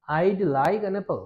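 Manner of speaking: I'd like an apple said very slowly, I'd stressed; slow contraction demo. Shown as a demonstration. Teacher slowly models the contracted I'd. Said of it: "তাহলে এটা কি বলবো আমরা?".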